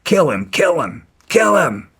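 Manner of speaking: retold yelling. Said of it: "started yelling out".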